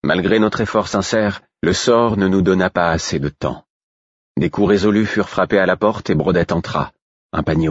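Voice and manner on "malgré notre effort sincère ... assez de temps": usual speaking voice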